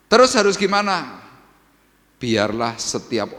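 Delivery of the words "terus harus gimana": loud rhetorical question. Acted. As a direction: ask it high and loud. Preacher voices congregation's question. (pertanyaan lantang dan tinggi)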